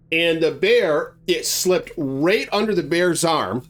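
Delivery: ordinary delivery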